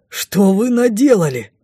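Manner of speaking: slow outraged question; appalled demand. A narrator's reading. King rebukes amid the fire.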